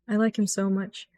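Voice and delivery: soft fond confession; soft adoring. Real, not acted. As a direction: say it tenderly to yourself. Affectionate aside.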